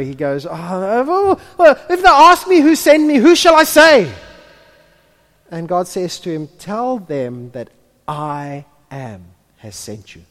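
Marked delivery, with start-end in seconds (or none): 0.5-4.1 high, loud, crying Moses in panic; "well, if they ask me" cried out high and frantic; panicked plea. Acted. Moses pleading with God. 6.6-10.2 calm divine voice. Acted. God answering Moses.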